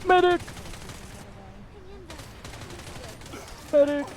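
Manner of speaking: high yelling call for a medic; man down shout. Real, not acted. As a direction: shout for help after going down. Downed player calling for medic.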